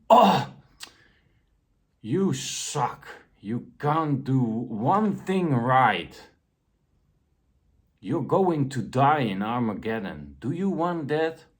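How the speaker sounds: mock harsh parent. Mock harsh parent scolding child.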